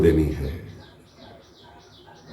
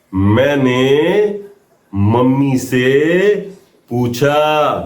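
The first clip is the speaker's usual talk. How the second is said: slow demo line; 6 semitones higher, much slower than usual. Shown as a demonstration. Teacher drilling students on the Hindi line, very slow. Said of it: "आप notebook देख के बोल रहे हैं".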